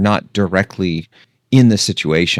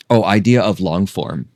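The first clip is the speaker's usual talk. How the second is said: usual speaking voice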